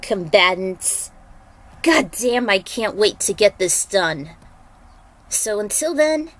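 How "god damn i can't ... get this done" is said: ordinary delivery, nothing marked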